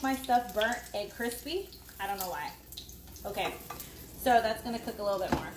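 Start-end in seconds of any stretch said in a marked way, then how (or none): none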